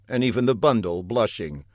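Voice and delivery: ordinary delivery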